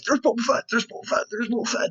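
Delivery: very high, squealed pointing-out; mock excited fans. People seeing all the Mandos underground, high mock squeal.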